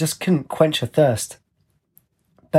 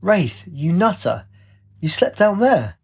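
higher, exclaimed narrated shout; 6 semitones higher than usual. A narrator's reading. She finds Wraith over the rail, shouting down. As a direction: call down in high-pitched disbelief, mildly raised. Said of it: "she shouted down".

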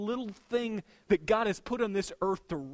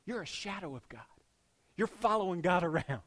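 ordinary talk

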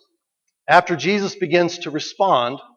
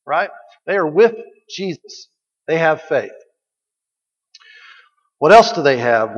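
normal talking voice

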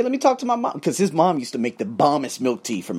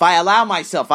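ordinary delivery

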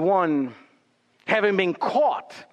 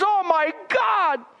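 higher, slow, dismayed gasp; David caught out; 9 semitones higher than usual. Retold or imitated. David caught by the prophet, slow and shocked. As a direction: let the shock sink in. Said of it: "David says".

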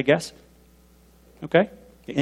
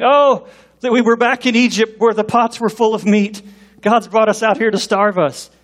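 imitated whining, pitched higher; whining complaint; 6 semitones higher than usual. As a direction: complain like grumbling Israelites. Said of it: "they're whining and complaining".

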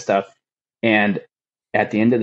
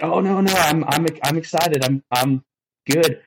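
higher, hurried backpedal, overselling it; flustered reassurance; 7 semitones higher than usual. Retold or imitated. Retold reply to the chief, a higher, flustered cover-up. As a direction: scramble to sound excited, higher.

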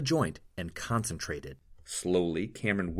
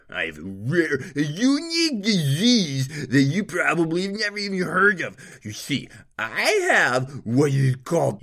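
a higher, louder, haughty nobleman sneering at everyone; arrogant nobleman; 9 semitones higher, 8 dB louder than usual. A narrator's reading. Nobleman scorning the city's healers, loud and haughty. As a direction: spit the insults like a spoiled lord.

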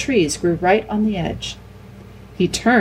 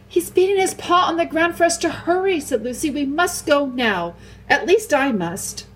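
put-on high, earnest girl's voice, slowing at the end; a young girl insisting they must go; urgent Lucy; 10 semitones higher than usual. Lucy urging the others.

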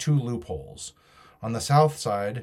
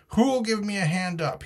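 much higher pitch, mild roar; roared demand; 10 semitones higher than usual. A narrator's reading. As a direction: cry out high, demanding help. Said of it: "he roared".

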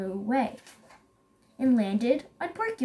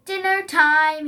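raised, loud predator's announcement; mild growl; 4 semitones higher, 7 dB louder than usual. A narrator's reading. Lion pounces on his supposed guest, mild growl. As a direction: growl it lightly, storybook style. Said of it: "he growled".

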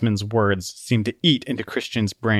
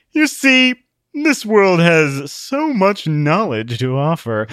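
smug lecturer; 6 semitones higher, 5 dB louder than usual. A narrator's reading. Wiseman lectures smugly.

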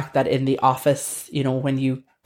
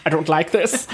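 laughing German refusal; 3 semitones higher than usual. Retold or imitated. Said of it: "they were like".

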